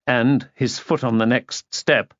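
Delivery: ordinary delivery, nothing marked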